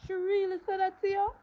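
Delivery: high, loud disbelief; shocked teacher, pitched high and loud; surprised teacher. Retold or imitated. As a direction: gasp it out like you can't believe it.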